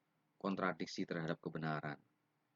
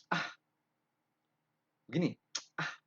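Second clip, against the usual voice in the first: dismissive; 7 semitones higher, 3 dB louder than usual. Shown as a demonstration. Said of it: "sudah tidak betul".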